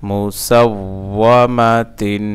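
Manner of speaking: "মুসাওওয়ামাতিন" slow and connected; joined reading pronunciation. Shown as a demonstration. Teacher reading it whole for learners, slowly joined. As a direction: link "মুসাওওয়ামাতিন" into one measured word. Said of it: "reading উচ্চারণ".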